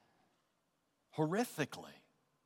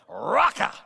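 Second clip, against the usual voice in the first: punchy, louder one-word jab; shouted insult; 5 dB louder than usual. Shown as a demonstration. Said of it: "Both words were shouts at others".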